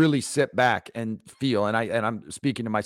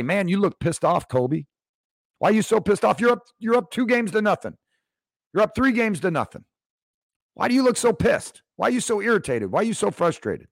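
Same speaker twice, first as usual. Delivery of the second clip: higher, needling reporter's questions; reporter pressing Kobe; 10 semitones higher than usual. Retold or imitated. Reporter grilling an irritated Kobe, pitched high and needling. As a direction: badger him like a pushy journalist. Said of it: "having somebody say".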